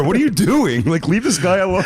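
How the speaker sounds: high-pitched mock police protest; exasperated cop. Cops scolding the man running the sting, exasperated. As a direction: plead like a fed-up cop. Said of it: "the cops show up and they go".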